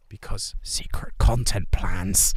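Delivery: hushed secret whisper. Real, not acted. Whispering to chat about secret plans.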